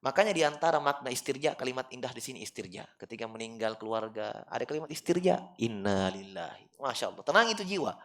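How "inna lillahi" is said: recited Arabic phrase. Shown as a demonstration. Citing the istirja phrase.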